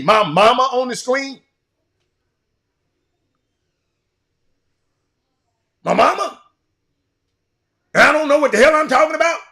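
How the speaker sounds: loud, heated, indignant rant; angry rant. Real, not acted. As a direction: fire back at whoever insulted your mama. Defending his mama on the show.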